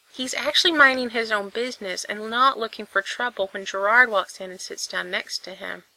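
ordinary delivery, nothing marked

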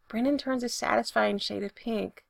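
usual speaking voice

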